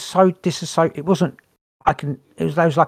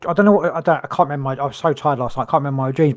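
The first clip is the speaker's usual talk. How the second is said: normal talking voice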